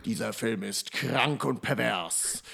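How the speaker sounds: low, slow, dramatic trailer announcer; horror trailer voice. Retold or imitated. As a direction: read it like an ominous movie trailer.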